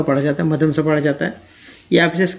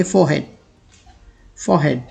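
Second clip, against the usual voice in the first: British forehead with silent r and the h sounded; British pronunciation; 3 semitones lower, much slower than usual. Shown as a demonstration. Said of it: "आर को साइलेंट कर देते हैं, एच को पढ़ते हैं".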